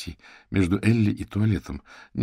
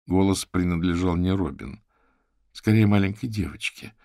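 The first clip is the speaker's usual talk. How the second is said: usual speaking voice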